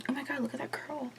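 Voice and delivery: breathy unvoiced whisper; whispered aside. Real, not acted. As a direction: murmur it half to yourself. Whispered aside about a curl.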